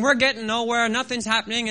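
ordinary delivery

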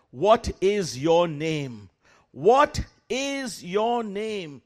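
very slow, loud, drawn out; commanding demand. Retold or imitated. Preacher demanding the demon's name, slow and loud. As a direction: press each word like an order.

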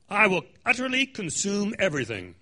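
booming prophetic proclamation; forceful. Acted. Preacher voicing the prophet's opening words, forceful. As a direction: thunder it out as the prophet. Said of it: "pretty forceful".

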